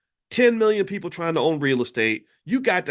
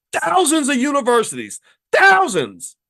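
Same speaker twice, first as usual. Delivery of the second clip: higher and louder, hammering thousands; emphatic; 9 semitones higher, 7 dB louder than usual. Real, not acted. Host ranting about Chinese universities, higher and louder. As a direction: blast the word thousands.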